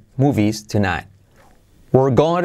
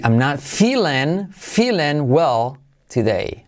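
slow model; 2 semitones higher, slower than usual. Shown as a demonstration. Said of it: "slow modelling".

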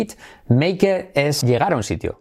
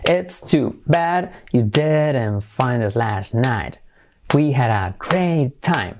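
slow, evenly separated teaching delivery; slow careful model; much slower than usual. Shown as a demonstration. Teacher modelling the example before the intonation, slow and careful. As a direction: say each word deliberately, stretching "great time". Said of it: "Entonación".

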